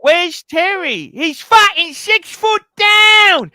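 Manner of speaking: loud high mock outburst; shouting. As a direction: bellow it, drawn out. Mock Minder quote, shouting.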